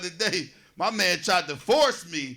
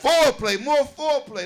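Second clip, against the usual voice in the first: emphatic plea; 8 semitones higher, 6 dB louder than usual. Acted.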